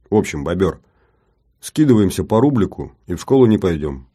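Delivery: usual speaking voice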